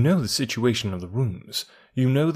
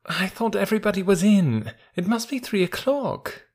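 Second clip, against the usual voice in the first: put-on a much higher, grumbling old concierge woken at night; 9 semitones higher than usual. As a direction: play her as a cross, sleepy doorkeeper.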